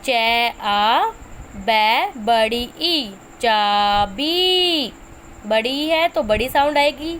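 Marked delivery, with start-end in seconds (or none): 0.0-4.9 very slow, syllable by syllable; slow teacher's sounding out of चाबी; slow spelling out. Shown as a demonstration. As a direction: stretch each sound so kids can follow. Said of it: "जैसे कि".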